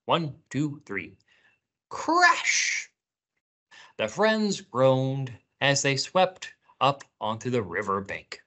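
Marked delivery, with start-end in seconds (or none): 1.9-2.9 high, hissy drawn-out crash; playful crash noise; big crash shout. Pretend. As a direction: call it out with the kids. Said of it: "say it again".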